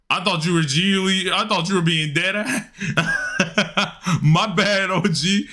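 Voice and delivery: high-pitched, breaking up with laughter; real laughing apology. Real, not acted. Streamer apologising to a viewer after a misreading, laughing. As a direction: crack up while owning the mistake.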